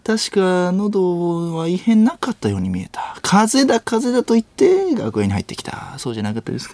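hammed-up detective laying out the case; 粗品任三郎. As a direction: build the accusation in a mannered, wavering delivery. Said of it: "粗品任三郎さん。". (刑事のものまねで、芝居がかった推理口調)

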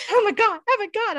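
high, loud breathless squeal; flipping out. Retold or imitated. Telling her husband about the star, flipping out. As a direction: freak out with joy. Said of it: "I'm, like, flipping out".

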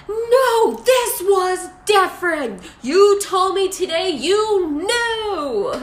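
mock a melodramatic breakdown; overwrought whine. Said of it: "It was so weird".

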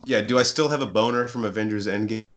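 ordinary talk

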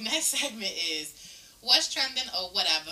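ordinary talk

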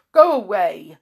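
loud, rude dismissal. A narrator's reading. Blind man dismissing a friendly stranger, rudely. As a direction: send him off sharply and loudly. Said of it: "said the blind man rudely".

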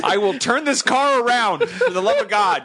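fed-up dad voice, rising high; exasperated parent. Acted. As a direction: threaten to turn the car around.

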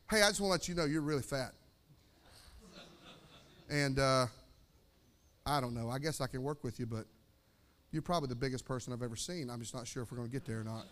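low, soft, quick-phrased blunt trainer; tactless fitness trainer. Acted. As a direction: deliver bad news in a low, offhand undertone. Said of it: "Like a fitness trainer".

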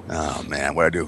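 low, soft imitation of remorse; small, hungover-sounding mock regret; mock contrite. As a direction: act sheepishly sorry, voice dropped and softened. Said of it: "sitting being contrite going".

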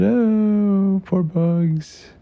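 put-on high, pitying little boy's voice; a child's high sympathetic sigh of a line; Tail, sad. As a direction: kid fretting for his friend, pitched high. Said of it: "And Tail said".

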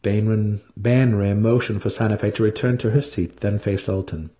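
ordinary delivery

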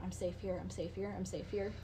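low, soft mantra, imitating self-comfort; repeating to oneself. Kidnap survivor reassuring herself over and over, hushed repetition. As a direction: retell it as a quiet, repeated murmur. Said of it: "Like, she just kept going".

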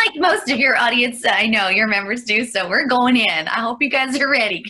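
laughing, excited. Real, not acted. Laughing hype to audience.

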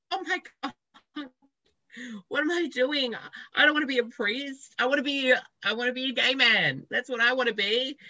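high, loud panicky inner voice; the sudden shock of a scary realization; freaking out. Retold or imitated. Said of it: "like, freaking out, like".

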